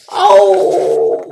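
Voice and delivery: loud, low, drawn-out "oh"; spooky ghost howl. Acted. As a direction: let out a long low howl to scare. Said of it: "He went".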